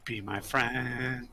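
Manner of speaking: slow, stretched-out pleading; playful plea. Pretend. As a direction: drag out each word in a mock entreaty. Playful plea, said two ways.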